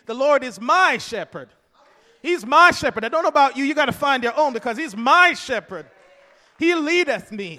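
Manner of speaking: fervent shouted sermon; shouted preaching. Acted. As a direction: shout the psalm with conviction. Shouted psalm, climax.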